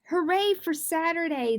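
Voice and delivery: loud, high hooray; cheering. A narrator's reading. Mother and daughter cheering for Saturday, loud. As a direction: cheer it out together. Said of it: "they cheered".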